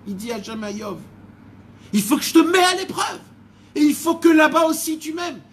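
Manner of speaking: loud, high, fervent voice of God; impassioned preaching. Acted. God declaring his love to Job, loud fervent preaching. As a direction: preach it at full force, swelling with love.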